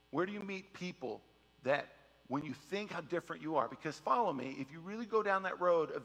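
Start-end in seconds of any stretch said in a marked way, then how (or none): none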